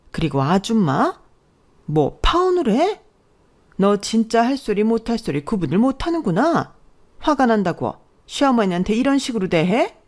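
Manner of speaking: offended, rising incredulity; indignant mother-in-law. Acted. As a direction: play an affronted mother-in-law telling off a daughter-in-law.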